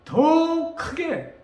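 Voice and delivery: "더 크게" stretched very slowly and pitched high; "더 크게" held long with rising force; drawn-out emphasis. Shown as a demonstration. Shows the louder cry.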